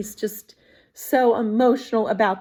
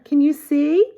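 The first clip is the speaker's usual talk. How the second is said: plain reading voice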